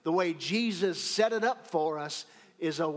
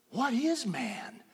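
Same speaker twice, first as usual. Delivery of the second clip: higher and slow, "is" lifted and drawn out; stress on IS; 6 semitones higher, slower than usual. Shown as a demonstration. Preacher wondering at God's care, drawn-out stress on "is". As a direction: swell on "is" in "What is man". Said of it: "emphasis on a different syllable".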